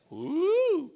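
high, rising and falling woo; a high whoop without extra volume; playful whoop. Real, not acted. Preacher celebrating hometown namesake, playful high whoop.

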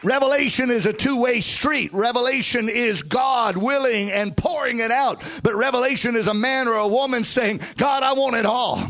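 rising preacher's whoop, sung lines; southern preacher. Acted. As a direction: build to a sung, shouted climax. Preaching builds to full force.

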